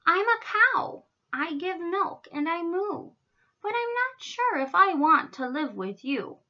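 high-voiced cow, uneasy; wary cow. A narrator's reading. Miss Moo answers roaring lions, wary and high.

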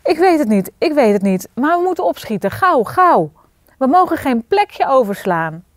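high, loud, flustered urging; frantic hurry. A narrator's reading. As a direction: chivvy them along in a panic. Frantic hurry before dragon arrives.

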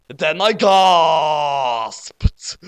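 very slow, loud melodramatic narration; melodramatic drawn-out gasped. A narrator's reading. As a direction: stretch the last word out hugely.

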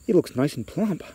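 a hint of a pleased mother; lightly voiced mother. A narrator's reading. Mother in a story delighted by the find.

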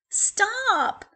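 high, loud call to stop. Acted. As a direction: shout after the runaway pup. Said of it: "called Evelina".

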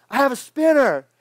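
put-on high, loud little-kid voice; mock kid bragging about his toy; proud kid. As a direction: show off like an eager eight-year-old. Kid shows off his spinner.

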